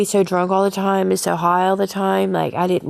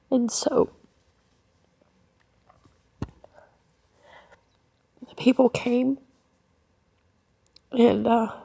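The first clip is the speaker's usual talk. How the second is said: high, strained voice breaking with tears; crying; 7 semitones higher than usual. Real, not acted. Mother recalling her children being taken, crying openly. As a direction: let the grief crack the voice as she tells it.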